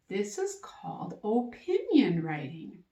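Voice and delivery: usual speaking voice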